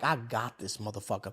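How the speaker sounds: low, very soft aside to himself; muttered aside. Retold or imitated. Son catching stepdad from the window, muttering. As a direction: say it under your breath, pitch dropped. Retold gleeful aside while spying.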